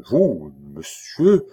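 slow, drawn-out disbelief; stunned murmur. A narrator's reading. Livid king turns on the cardinal, stunned. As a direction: let each word hang, aghast. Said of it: "murmura le roi, devenu livide".

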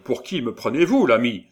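high, loud offended challenge; indignant. A narrator's reading. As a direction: bristle at being taken for a murderer. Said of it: "dont la conscience se révoltait". (indigné, voix haute et forte)

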